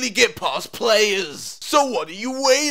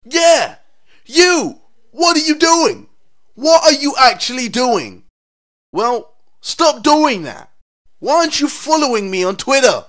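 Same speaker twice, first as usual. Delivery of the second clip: shouting; 9 dB louder than usual. Acted. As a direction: yell straight at the viewer. Calling out the viewer loudly.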